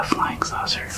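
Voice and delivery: ordinary delivery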